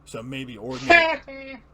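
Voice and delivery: high, loud, slow sneering villain; manic Joker. Acted. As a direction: stretch it out like a gleeful madman. Joker taunting, dramatic reading.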